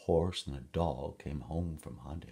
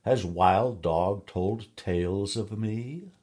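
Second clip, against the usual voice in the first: louder, slow, indignant question; angry cat; 4 semitones higher, 8 dB louder, slower than usual. A narrator's reading. Cat challenges the woman, slow and indignant. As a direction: let the offence swell through each word. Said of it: "Cat grew very angry".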